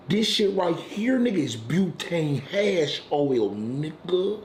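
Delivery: slow, drawn-out reveal. Retold or imitated. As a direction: lay it out slow and proud like a dealer showing off. Friend slowly presenting the drug.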